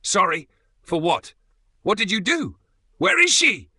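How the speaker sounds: building from a loud demand to a high cracking roar. A narrator's reading. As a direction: rage rising until the voice breaks. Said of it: "My voice cracks into a roar".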